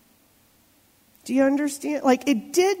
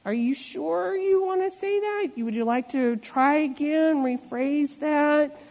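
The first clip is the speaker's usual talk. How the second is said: high-pitched, mock-polite warning; pointed disbelief; 4 semitones higher than usual. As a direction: offer a second chance, stretching the last words. Voicing a warning look at someone.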